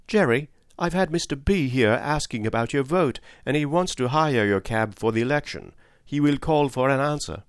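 a gentle hint of a young wife reporting news; Polly's voice. Polly, the cab driver's wife, speaks.

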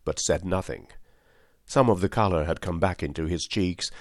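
ordinary delivery, nothing marked

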